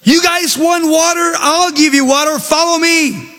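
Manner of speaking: mock angry yell, pitched high; hammed-up shout of an angry leader. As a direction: Moses snapping at the grumbling crowd. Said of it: "he starts yelling at the people".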